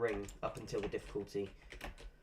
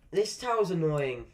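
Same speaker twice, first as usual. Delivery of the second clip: loud, slow, higher-pitched complaint; annoyed; 6 semitones higher, 9 dB louder, slower than usual. Real, not acted. Gamer struggling to get started on a tower, annoyed. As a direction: complain loudly, dragging the words out.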